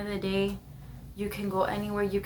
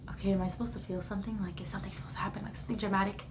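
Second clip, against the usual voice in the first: inner thought; 7 dB quieter, faster than usual. Retold or imitated. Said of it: "in my head I was like".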